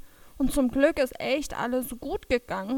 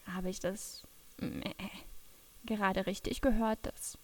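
much softer, half to herself; hushed disbelief; 5 semitones lower, 9 dB quieter than usual. Acted. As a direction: murmur it, hardly trusting your ears. Overheard Bruno, unsure, hushed. (leise und ungläubig)

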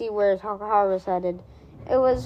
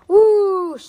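ordinary delivery, nothing marked

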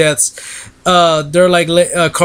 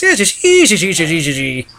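dancing leprechaun. Retold or imitated. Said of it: "the leprechaun just, like, dances on him".

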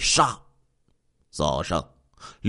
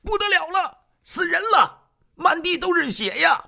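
shrill-pitched, far higher alarmed cry; loud panicked shout. A narrator's reading. Security guard shouts the grisly discovery to everyone below, loud alarmed cry. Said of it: "大声喊道".

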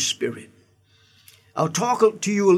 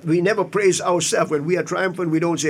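normal talking voice

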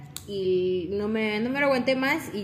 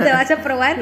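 loud, laughing quote of him asking; giggling loud mock question; retold question through laughter; 5 semitones higher, 11 dB louder than usual. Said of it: "entonces me dice".

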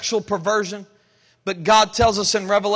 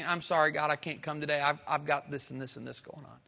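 softer, mumbled half-hearted excuse; 5 semitones lower, 9 dB quieter than usual. Retold or imitated. Believer excusing himself to God: soft, half-hearted mumble. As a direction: brush God off with a limp apology. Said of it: "with just a half-hearted indifference".

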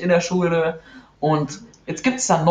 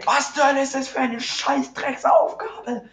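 exasperated outburst; 10 semitones higher than usual. Real, not acted. Outraged at math homework.

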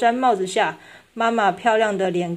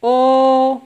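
put-on child's oh; 4 semitones higher, 7 dB louder than usual. A narrator's reading. Child reacts to collector's answer.

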